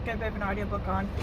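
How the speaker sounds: normal talking voice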